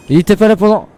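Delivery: shaken, high and loud; scared horror game streamer. Real, not acted. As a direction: still rattled from the jump scare. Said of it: "Il m'a fait peur, le con".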